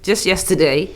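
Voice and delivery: usual speaking voice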